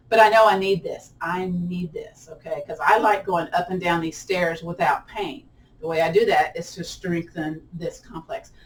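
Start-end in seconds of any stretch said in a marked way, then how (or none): none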